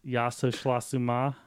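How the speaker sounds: mock Russian gibberish, slow at the end; hammed-up fake Russian accent. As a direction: ham up a fake Russian phrase. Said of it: "It's probably like".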